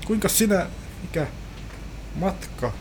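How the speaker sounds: hammed-up cabin crew pitch; chirpy flight attendant. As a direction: play the overly keen steward selling snacks.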